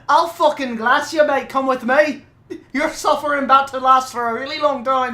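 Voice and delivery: hammed-up Scouse tough guy; Scouse accent. Playing Pinhead with a Liverpool accent, menacing. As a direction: threaten like a Liverpool hard man. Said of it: "Scouse Pinhead".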